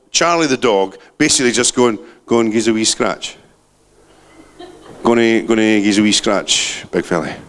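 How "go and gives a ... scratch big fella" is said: lightly voiced dog, lower; a hint of a lazy dog asking for scratches; the dog asking his owner for a scratch, low and lazy; dog's voice. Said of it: "It doesn't actually talk, my dog".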